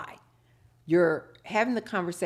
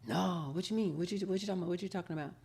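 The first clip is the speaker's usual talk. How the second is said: man denying; 6 dB quieter, much faster than usual. Retold or imitated.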